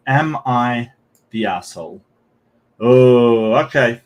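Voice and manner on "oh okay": a sudden loud "Oh"; startled oh. Real, not acted. Streamer caught off guard by the post's question, loud startled oh.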